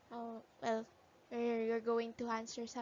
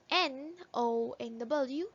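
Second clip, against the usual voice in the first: ordinary talk, nothing marked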